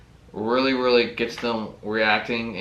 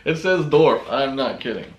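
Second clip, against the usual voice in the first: laughing; 4 semitones higher, 6 dB louder than usual. Real, not acted. Laughing at misspelled bait name.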